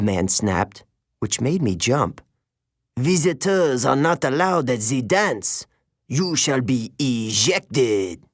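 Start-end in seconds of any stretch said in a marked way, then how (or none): 3.0-8.2 put-on stern teacher with a light French accent, slow at the end; clipped French-accented order to leave. A narrator's reading. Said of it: "He had an accent, French maybe".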